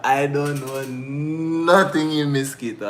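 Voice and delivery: said through giggles, slow; laughing. Real, not acted. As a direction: hold back laughter while saying it.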